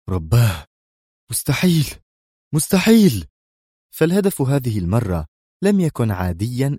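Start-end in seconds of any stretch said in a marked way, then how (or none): 0.1-3.2 from dread whisper to horrified outcry; horrified mutter rising to a cry. A narrator's reading. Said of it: "مغمغما في ارتياع". (همس مرتاع يتصاعد إلى صيحة)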